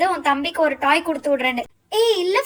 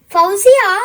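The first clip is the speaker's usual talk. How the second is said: calling out; 3 semitones higher, 7 dB louder, slower than usual. Acted. Calling out to friend.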